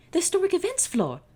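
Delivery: high, loud exclamation; sudden realization. Acted. Sacagawea realizes where Will went, high loud alarm. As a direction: blurt it out in alarm.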